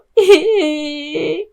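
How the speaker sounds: a laugh that breaks into crying; teary laugh. Acted. Touched girl with her friends, teary laugh. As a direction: laugh and sob at once.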